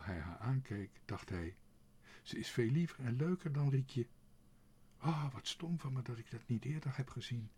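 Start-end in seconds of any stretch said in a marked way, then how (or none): 2.2-7.5 higher, livelier thinking aloud; suddenly smitten, voice lifting; delighted realisation. A narrator's reading. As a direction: realise it with a burst of enthusiasm. Sudden realisation about the girl.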